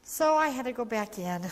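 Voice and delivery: ordinary talk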